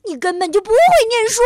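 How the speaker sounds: fast, high, loud outburst; huffy anger. A narrator's reading. Bengbeng insults friend's reading, furious. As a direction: blurt a furious put-down. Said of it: "气呼呼地说".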